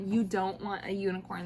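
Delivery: usual speaking voice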